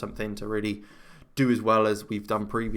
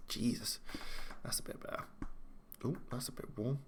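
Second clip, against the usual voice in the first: quiet; 14 dB quieter than usual. Real, not acted. Fiddling with lights, hushed.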